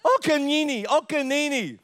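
put-on high little-girl chant; high, insistent toddler imitation. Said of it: "we don't understand a word she's saying".

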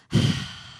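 long voiceless sigh outward; sigh of release. Shown as a demonstration. Preacher showing congregation the sound of release, relieved sigh. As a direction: let a breath whoosh out in relief. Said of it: "with the action comes a sound".

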